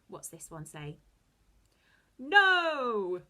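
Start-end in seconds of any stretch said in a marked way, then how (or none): 2.2-3.2 loud heroic shout; superhero voice. Shown as a demonstration. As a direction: blast it out like a superhero. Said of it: "Let me hear those superhero voices".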